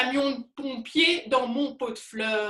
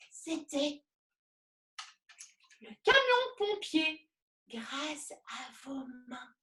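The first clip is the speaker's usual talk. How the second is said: whispering; 9 dB quieter than usual. Acted. Whispered after the song.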